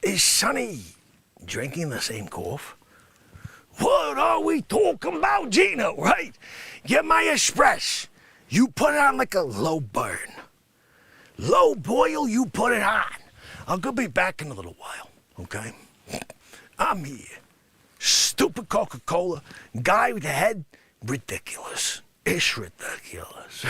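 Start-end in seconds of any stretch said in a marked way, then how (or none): 3.8-13.0 high, loud ordering voice; comic regular ordering his espresso loudly; loud character voice. Acted. 21.0-23.6 unvoiced whisper, low; a disgusted whispered aside.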